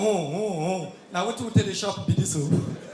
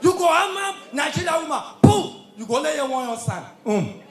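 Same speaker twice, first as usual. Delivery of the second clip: shouted crowd hype call, each line barked out; 3 semitones higher, 3 dB louder than usual. Acted. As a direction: pump up the crowd like a party MC. MC hyping crowd with call-and-response.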